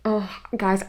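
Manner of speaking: ordinary talk, nothing marked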